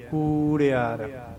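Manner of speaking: ਕੂੜਿਆਰ with a drawn-out long ਕੂ, slow; long vowel. Shown as a demonstration. Teacher stretches the long vowel in ਕੂੜਿਆਰ. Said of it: "ਕੂ ਨੂੰ ਥੋੜ੍ਹਾ ਜਿਹੀ ਲੌਂਗ sound".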